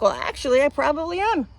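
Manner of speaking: lightly kidding comeback; joking admission. Retold or imitated. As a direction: own up to it as a joke. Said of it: "I kinda joked".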